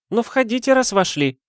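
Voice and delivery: high, dismissive woman's voice; an exasperated lady waving off his logic; lady's voice. Acted. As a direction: brush him off in a high affected tone. Lady greets the detective.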